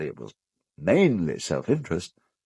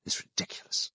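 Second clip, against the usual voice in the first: whispered; 12 dB quieter than usual. Acted. Contemptuous dismissal of the soul.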